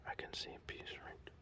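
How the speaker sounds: hushed, quick conspiratorial whisper; secretive aside, barely audible. Pretend. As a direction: whisper it fast under your breath. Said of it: "What are you whispering?".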